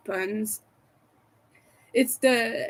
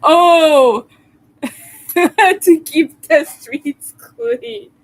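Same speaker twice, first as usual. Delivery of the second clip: loud, high words breaking into laughter; laughing; 5 semitones higher, 10 dB louder, much slower than usual. Real, not acted. Streamer cracking up at a friend's pun, laughing. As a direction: crack up and slow down through the laughs.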